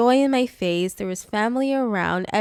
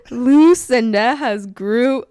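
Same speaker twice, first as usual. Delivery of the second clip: slow, laughing grand announcement; hammed-up herald declaring it; mock proclamation; 6 dB louder, much slower than usual.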